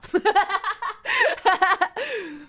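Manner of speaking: high burst of laughter. Real, not acted. Laughing at own joke.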